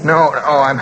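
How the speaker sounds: edgy, trying to get away from him; nervous refusal. Acted. Nervous driver refuses stranger.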